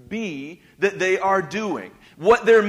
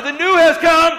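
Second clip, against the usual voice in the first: shouted, much higher "The new has come."; louder; 10 semitones higher, 12 dB louder than usual. Shown as a demonstration. Pastor firing up the congregation, shouting. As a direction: shout it out with full energy. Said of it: "Tell them louder".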